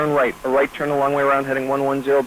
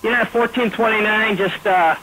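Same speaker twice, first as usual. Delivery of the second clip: urgent; 7 semitones higher than usual. Real, not acted. Controller halts a moving jet.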